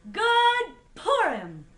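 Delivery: loud, high, drawn-out greeting; exuberant catchphrase. A narrator's reading. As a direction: proclaim it as a joyful signature call. His catchphrase all year.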